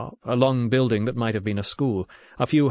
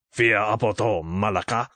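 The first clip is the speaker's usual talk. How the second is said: threatening. A narrator's reading. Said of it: "said the man threateningly".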